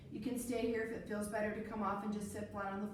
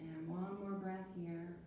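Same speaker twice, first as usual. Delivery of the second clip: calm guided breathing; 4 semitones lower, 7 dB quieter than usual. Acted. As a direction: guide the breath gently and calmly. Leads calm breathing practice.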